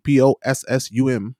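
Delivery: each letter of "P O S S U M" named separately; spelling it out. Shown as a demonstration. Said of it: "If you look up a possum".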